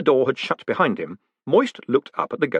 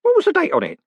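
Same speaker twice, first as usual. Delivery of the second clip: put-on high, urgent old-man question; fretful old clerk; anxious old postman; 4 dB louder, faster than usual. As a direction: press for the date, alarmed. Groat pressing anxiously.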